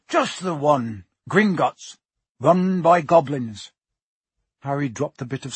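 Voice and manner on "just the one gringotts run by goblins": louder, knowing giant; Hagrid, matter-of-fact. Acted. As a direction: explain it weightily to a newcomer.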